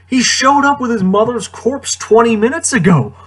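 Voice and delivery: exasperated. Real, not acted. Exasperated at Padme's line.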